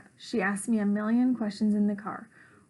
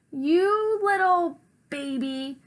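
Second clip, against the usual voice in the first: slow, high, drawn-out insult; angry taunt; 6 semitones higher, 4 dB louder, slower than usual. A narrator's reading. Rosabelle, pushed over the edge, taunts her brother angrily. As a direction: stretch it out in rising fury. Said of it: "Rosabelle could get this angry".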